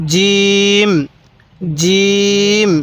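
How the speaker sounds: hard, firm j in জিম, vowel held long; firm pronunciation. Shown as a demonstration. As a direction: press the j of জিম, then sustain it evenly. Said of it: "জিমটা আমরা শক্ত করে উচ্চারণ করব".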